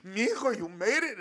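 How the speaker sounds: choked up. Retold or imitated. Dad tearfully proud of son's Budweiser truck, voice cracking. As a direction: voice cracking, loud and emotional. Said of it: "his voice is crackling".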